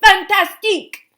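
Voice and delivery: loud, high mock French exclamation; imitating teacher's French. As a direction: copy the teacher's Fantastique.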